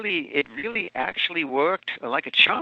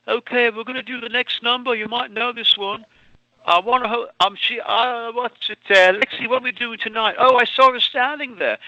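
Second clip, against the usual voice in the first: much higher, quick Liverpool stage patter; Liverpudlian accent; 10 semitones higher, 5 dB louder than usual. Retold or imitated. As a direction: charm the crowd like a Beatle from Liverpool. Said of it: "put on a Liverpudlian accent".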